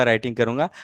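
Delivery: ordinary talk, nothing marked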